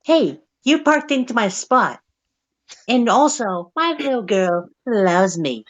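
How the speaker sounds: high, whiny entitled Karen. Retold or imitated. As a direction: squeal it like an outraged Karen. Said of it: "imagine a Karen just walks up".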